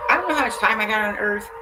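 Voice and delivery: usual speaking voice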